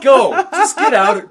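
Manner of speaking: high, loud, laughing brush-off; laughing mock dismissal. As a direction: shoo them away through laughter. Acted dismissive character quote.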